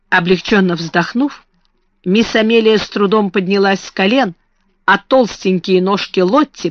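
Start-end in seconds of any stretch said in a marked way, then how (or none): none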